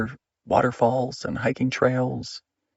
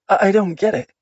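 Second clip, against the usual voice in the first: higher, louder, faltering with anxiety; choked words; 6 semitones higher, 5 dB louder than usual. Acted. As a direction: force the words past a lump in the throat. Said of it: "She choked the words out".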